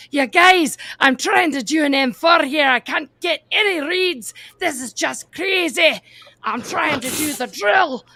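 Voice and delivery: put-on high, loud, exasperated Scottish accent; pissed-off Scottish woman. Trainee protesting to laughing classmates she can't get a read, exasperated Scottish accent. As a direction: mimic a fed-up Scottish trainee pleading.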